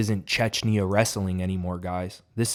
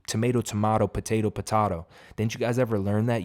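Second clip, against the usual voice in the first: normal talking voice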